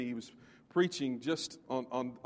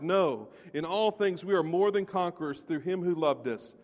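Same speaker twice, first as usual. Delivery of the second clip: raised, ringing scripture reading; preaching scripture; 4 semitones higher, 5 dB louder than usual. Acted. Preacher reading Romans to his congregation, full force. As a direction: proclaim the passage to the congregation.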